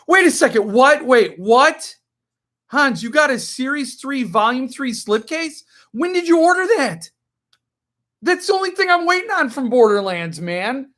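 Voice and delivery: high, incredulous "wait, what?" rising up; shocked disbelief. Real, not acted. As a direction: caught off guard, pitch jumps way up. Shocked at a viewer's slipcase.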